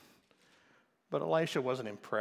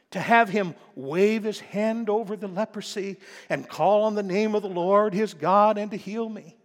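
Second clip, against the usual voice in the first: higher, louder grumbling; offended complaint; 7 semitones higher, 8 dB louder than usual. Retold or imitated. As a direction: complain like a proud man snubbed. Naaman's complaint quoted.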